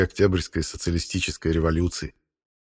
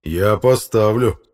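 slow, loud threat; threatening; 3 semitones higher, 6 dB louder, much slower than usual. Acted. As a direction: draw it out as a warning. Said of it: "с угрозой сказал Фёдор Игнатьевич". (медленно, с угрозой)

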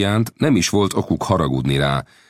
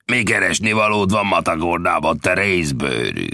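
menacing bandit leader; 4 semitones higher than usual. A narrator's reading. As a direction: taunt him like a saloon bully. Bandit leader challenges the Indian.

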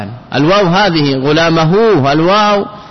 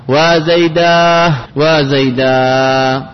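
drawn-out verse recitation; much slower than usual. Shown as a demonstration.

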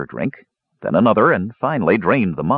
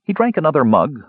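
ordinary delivery, nothing marked

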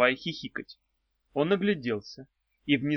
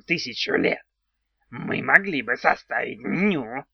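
thankful odd little goblin voice; grateful goblin. A narrator's reading. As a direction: goblin eager to please. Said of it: "благодарно сказал домовой".

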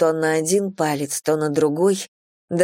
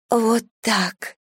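higher, breathless gasp; 5 semitones higher than usual. A narrator's reading. A woman urging her lover on, gasping. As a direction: urge on while short of breath. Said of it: "задыхаюсь я".

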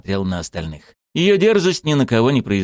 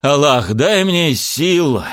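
raised voice, dragging out the plea; exasperated anger; 7 semitones higher, 4 dB louder, slower than usual. Acted. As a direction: appeal to heaven, at the end of your patience. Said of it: "разозлился Шамиль". (раздражённо, с досадой, повысив голос)

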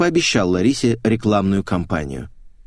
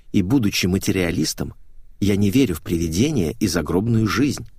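ordinary delivery

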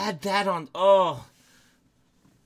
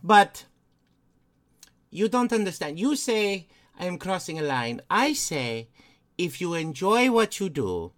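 put-on hammy Eastern European accent; Lady Bathory. Lady Bathory defending her hobby to Stephen, Eastern European accent. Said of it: "I don't know why I sound like the guy who".

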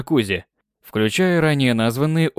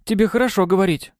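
offended; 8 semitones higher than usual. Acted. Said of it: "обиженно ответила Навахо".

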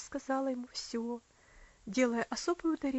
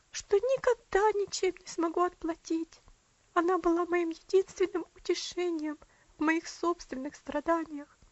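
higher, louder, tearful woman's voice; a sister pours out her love for the dying child; grieving sister; 5 semitones higher, 5 dB louder than usual. A narrator's reading. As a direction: let the grief lift her voice high. Adelaide's voice, grieving.

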